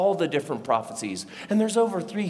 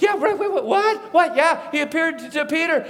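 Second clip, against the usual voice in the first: high-pitched, stumbling surprise; startled disbelief; 10 semitones higher, 4 dB louder than usual. Acted. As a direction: react confused, voice jumping up. Runners hear surprising news back.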